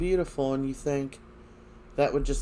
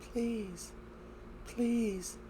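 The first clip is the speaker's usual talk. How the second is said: pleading; 10 semitones higher, 9 dB quieter than usual. Retold or imitated. Marlena begging to go home.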